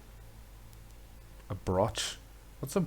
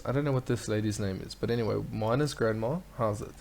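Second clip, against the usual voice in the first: ordinary talk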